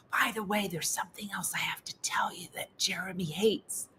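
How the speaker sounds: whispered secret aside. Retold or imitated. Friend leaning over at a seminar, whispering. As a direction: lean in and whisper it.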